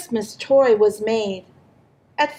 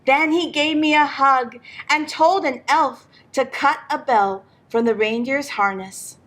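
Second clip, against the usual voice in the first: ordinary delivery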